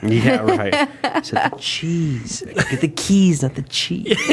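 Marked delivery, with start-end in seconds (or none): 1.2-4.2 high, zany cartoon voice; The Mask impression. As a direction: ham it up like a cartoon character. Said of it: "I really like The Mask".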